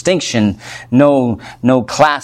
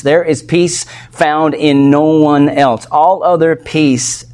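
ordinary talk

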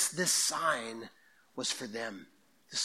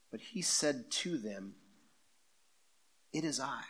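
scripture reading. Acted. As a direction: read it like holy text, unhurried. Reads from John aloud.

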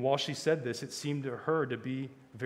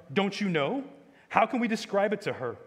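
higher-pitched baffled questions; exasperated; 7 semitones higher, 6 dB louder than usual. A narrator's reading. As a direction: ask as if at your wits' end. Scrub exasperated, at a loss.

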